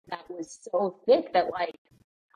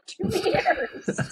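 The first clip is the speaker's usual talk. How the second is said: laughing; 13 semitones higher than usual. Real, not acted. Laughing at own story.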